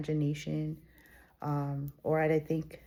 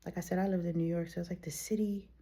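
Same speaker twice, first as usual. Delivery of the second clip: usual speaking voice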